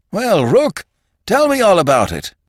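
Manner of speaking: loud, high, hearty boss greeting; heavy geniality. A narrator's reading. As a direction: boom it out with overdone joviality. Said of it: "with heavy geniality".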